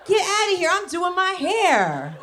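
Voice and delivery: high, slow shooing retort; mock dismissive wife. Wife shooing her husband away from her hair, slow and high. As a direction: wave him off with exaggerated patience. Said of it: "wife answering husband about hair".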